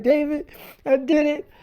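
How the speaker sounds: lightly mimicked tearful caller, pitched high; a touch of high-voiced upset, retold; imitated crying. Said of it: "called me crying".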